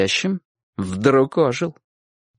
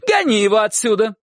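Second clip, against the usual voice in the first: high urgent command; shouted order; 8 semitones higher than usual. A narrator's reading. Aunt urging Uncle Kolya to chase him, shouting. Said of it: "крикнула тётушка".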